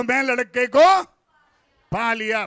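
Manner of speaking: loud, ringing declaration to lead the crowd; leading the congregation's chant; bold call-out. Shown as a demonstration. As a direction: proclaim it for them to repeat. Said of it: "अब लड़कियां बोलो".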